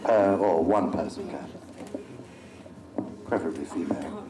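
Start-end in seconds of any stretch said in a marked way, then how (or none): 3.3-4.2 cheeky whispered aside, almost no voice. Real, not acted. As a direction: slip it in as a sly whisper.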